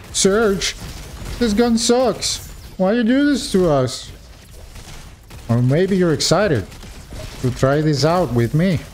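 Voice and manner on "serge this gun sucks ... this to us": higher-pitched mock outcry at the streamer; mock complaining viewer. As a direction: whine like an upset viewer, mock-screaming without real volume. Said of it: "Maybe you're screaming at me".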